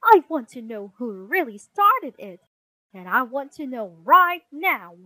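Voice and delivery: loud, demanding mother; stern, forceful parental order; angry mama's shout. Acted. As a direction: demand answers at full voice. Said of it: "she shouted".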